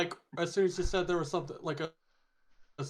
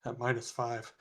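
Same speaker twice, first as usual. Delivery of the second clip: ordinary talk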